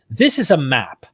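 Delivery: ordinary talk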